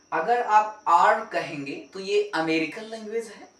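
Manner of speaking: usual speaking voice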